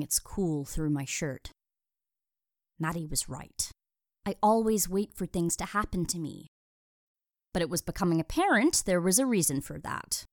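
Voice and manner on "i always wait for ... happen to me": ordinary delivery, nothing marked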